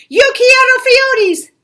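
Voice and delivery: a hammed-up grand decree, high and loud; loud high mock-solemn decree; somber decree. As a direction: proclaim it like a grave ruler. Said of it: "in his most somber voice decreed".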